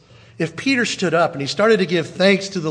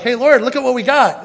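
higher, louder, proud "look at what we got"; eager offering; 6 semitones higher, 6 dB louder than usual. Retold or imitated. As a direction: show it off to the Lord eagerly. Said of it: "at first you think it's like".

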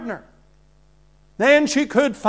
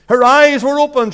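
loud projection; 4 semitones higher, 8 dB louder than usual. Real, not acted. Preacher proclaiming, raised voice.

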